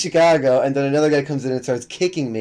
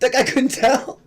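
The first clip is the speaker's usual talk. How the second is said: incredulous; 9 semitones higher, slower than usual. Real, not acted. Incredulous mocking question.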